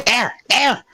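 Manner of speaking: very high-pitched Strange Claw voice; excited creature cry. Acted. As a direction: cry out high while pointing it out. Strange Claw urgently points at it.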